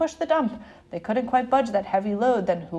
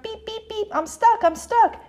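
high beeps, then louder pleas; little truck crying out; 9 semitones higher, 4 dB louder than usual. A narrator's reading. Stuck little truck to the farm animals, crying out. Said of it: "cried the little blue truck".

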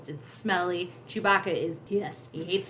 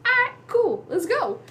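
breezy princess; 6 dB louder than usual. Retold or imitated. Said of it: "And she goes".

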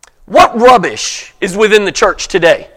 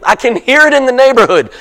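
ordinary talk, nothing marked

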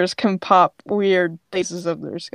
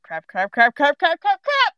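rising panic, climbing higher with each crap; panicky crap; 6 semitones higher, 3 dB louder than usual. Acted. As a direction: start nervous and spiral up into a squeak. Grape panics in the scene.

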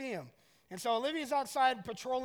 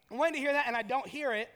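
ordinary talk, nothing marked

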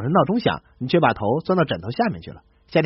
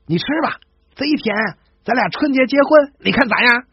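put-on higher, eager northeastern accent; Harbin lad; 7 semitones higher than usual. A Harbin boy hands his girl candied haws, eagerly higher in a northeastern accent. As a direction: blurt it out after running up. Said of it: "气喘吁吁的跑到女孩身边".

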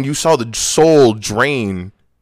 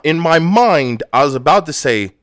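ordinary delivery

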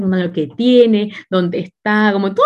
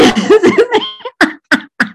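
high laugh; 11 semitones higher than usual. Real, not acted. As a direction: crack up at the joke. Laughing at friend's joke.

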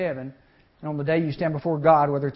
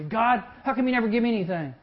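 2 higher, petulant whining to God; 3 whine it like a spoiled child; 4 believer complaining to God, petulant whine; 1 whiny complaint; 6 semitones higher than usual. Retold or imitated. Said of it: "But if we say".

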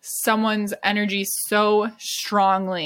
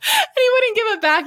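laughing; 13 semitones higher, 3 dB louder than usual. Real, not acted. Funny bird story.

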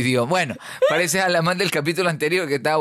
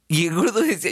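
ordinary talk, nothing marked

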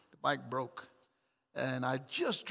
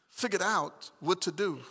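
normal talking voice

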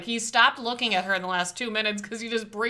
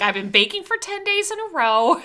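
giddy frazzled baker; 9 semitones higher, 5 dB louder than usual. Retold or imitated. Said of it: "She's like".

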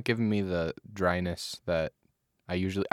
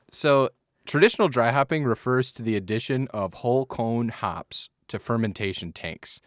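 ordinary talk, nothing marked

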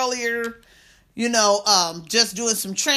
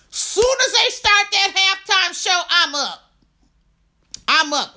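excited; 8 semitones higher than usual. Real, not acted. Hyped about dancing at show.

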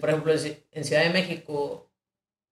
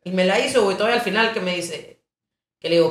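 ordinary delivery

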